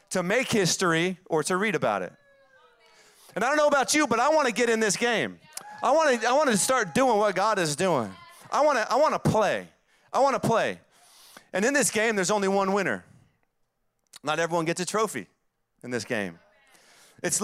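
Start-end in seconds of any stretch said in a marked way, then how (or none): 3.4-13.0 raised, impassioned preacher voice; fired-up preaching. Acted. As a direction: preach it with rising fire. Preacher rising to full force.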